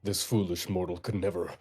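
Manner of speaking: inner villain voice. Acted. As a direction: growl it like a scheming evil mastermind. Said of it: "in his head, he's like".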